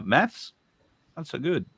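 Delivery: ordinary delivery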